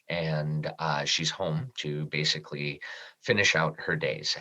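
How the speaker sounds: quiet, emotional recollection of the sick mother; hushed and moved. Real, not acted. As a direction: let the words sink as the memory hurts. And I started crying right there.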